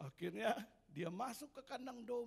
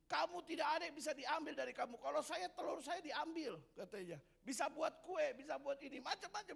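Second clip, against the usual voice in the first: bragging hen; 7 semitones higher than usual. Acted. Hen brags to the dog.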